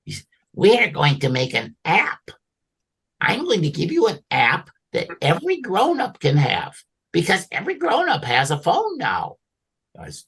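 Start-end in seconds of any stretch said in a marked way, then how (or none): 0.5-9.3 eager elf voice. Acted. Santa voicing Sparky.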